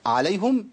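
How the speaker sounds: عليهم said with a damma as عليهُم, mixing readings; wrong reading. Shown as a demonstration. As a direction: show the mistaken عليهُم. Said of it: "ما تنفع خالص".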